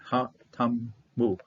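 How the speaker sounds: "Ha Tamu" slowly, syllable by syllable; sounded out slowly. Shown as a demonstration. Teacher breaks down Hebrew for learners, "Ha Tamu" slowly sounded out. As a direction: draw out "Ha Tamu" with gaps between syllables.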